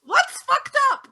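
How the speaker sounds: put-on high, loud, slow scandalised old neighbour; old neighbour overhears a swear, high and shocked; shocked old lady. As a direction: gasp it out, scandalised.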